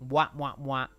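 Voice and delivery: quick smacking kisses; kiss noises. Real, not acted. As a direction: blow kisses at the chat. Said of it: "That's, that's a weird kiss noise".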